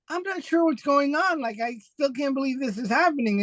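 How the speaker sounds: can't believe the news; stunned disbelief. Retold or imitated. To award caller, retold stunned disbelief.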